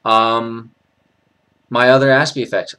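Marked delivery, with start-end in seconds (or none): none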